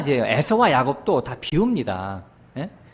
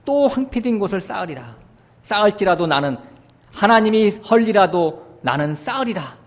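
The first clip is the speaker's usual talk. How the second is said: higher, louder, stubbornly defiant; defiant boast; 7 semitones higher, 3 dB louder than usual. Acted. Preacher voices Edom defying God in a higher, louder, stubborn boast. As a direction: Edom boasting it will rebuild whatever God tears down. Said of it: "하나님과 싸운다는 얘기죠".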